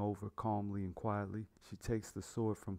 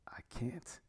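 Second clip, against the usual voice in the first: hushed, higher-pitched near-whisper; pained refusal; 4 semitones higher, 6 dB quieter than usual. A narrator's reading. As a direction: whisper it, barely able to answer. Father refuses to bury his daughter.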